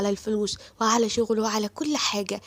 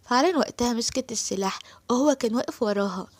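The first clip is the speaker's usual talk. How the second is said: ordinary delivery, nothing marked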